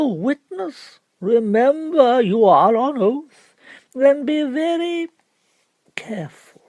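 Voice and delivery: high, slow, booming warning; stern pompous barrister. Acted. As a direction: lean on each word like a threat. Barrister warns witness on oath.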